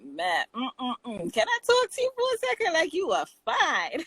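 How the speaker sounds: flirty guy voice. Retold or imitated. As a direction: sweet-talk her like a smooth guy. Man flirting with woman on street.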